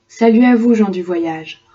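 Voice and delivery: loud, bold poetic salute; ringing greeting. Acted. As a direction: call it out to the travellers. Poem opening greeting.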